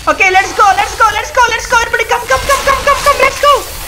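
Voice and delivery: excited rallying. Real, not acted. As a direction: whip the team up. Rallying team, rising pitch.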